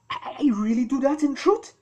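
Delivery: high, puzzled voice; confused animal. A narrator's reading. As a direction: wonder aloud, baffled. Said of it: "they would ask themselves".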